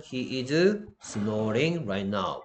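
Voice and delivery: very slow, deliberate English example; slow model sentence. Shown as a demonstration. Teacher reading an example sentence to students, slowly. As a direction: spell the sentence out word by word.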